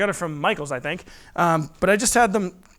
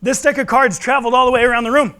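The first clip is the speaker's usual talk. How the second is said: showman announcement; 5 semitones higher, 5 dB louder than usual. Acted. Magician's showy reveal to audience.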